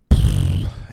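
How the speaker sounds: long, loud exhaled sigh; resigned sigh. Retold or imitated. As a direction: let out a heavy, dismissive puff. Retold shrug at hurt acquaintance.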